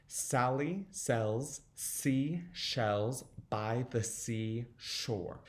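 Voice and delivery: very slow, word-by-word tongue twister; slow, every sound. Shown as a demonstration. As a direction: give every word and sound its own space. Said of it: "start off slow and make sure you get every".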